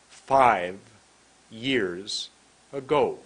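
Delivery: very slow, each word set apart by a long pause; one word at a time. Shown as a demonstration. Teacher showing students how to speak slowly, word by word. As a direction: stretch out every word and stop fully after it. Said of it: "saying one word at a time".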